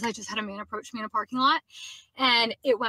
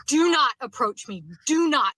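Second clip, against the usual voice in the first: retold yell; 2 semitones higher, 5 dB louder than usual. Woman yelling at man who approached her, loud slow warning. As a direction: shout him away, each word spaced. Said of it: "I just kept saying it over and over".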